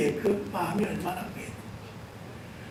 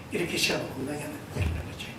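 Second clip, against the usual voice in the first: usual speaking voice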